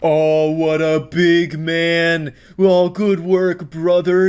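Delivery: slow, mocking fake admiration; sarcastic praise. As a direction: drip with sarcasm.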